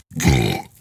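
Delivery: deep, guttural belch; low burp bursting out; real burp. Real, not acted. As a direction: let out a big belch. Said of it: "That was a fucking Randall Flagg burp".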